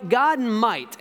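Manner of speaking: "might" set apart with slow, heavy emphasis; slow down and lean hard on "might"; stress on might. Real, not acted. Preacher qualifying what God asks, strong stress on "might". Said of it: "I highly emphasize the word might".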